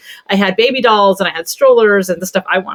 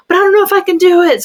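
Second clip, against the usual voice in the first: much higher, fast and fearful; scared toy voice; 10 semitones higher, faster than usual. Pretend. Mom mirroring her son's tone as a toy, higher and fast. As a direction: mirror the child's pitch, scared. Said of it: "the same kind of vocal intonation".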